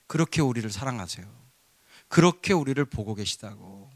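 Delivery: ordinary delivery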